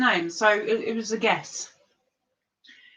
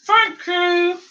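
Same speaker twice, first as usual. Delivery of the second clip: ordinary delivery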